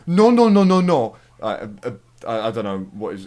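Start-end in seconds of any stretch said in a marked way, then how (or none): 0.1-1.2 high, loud insistent no no no; retold loud no. Retelling the taxi bloke loudly cutting in. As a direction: shout him down like the bloke did. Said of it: "some other bloke came out and went".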